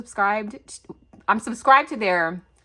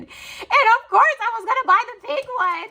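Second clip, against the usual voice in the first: excited squeal; 7 dB louder than usual. Real, not acted. Gushing over pink planner.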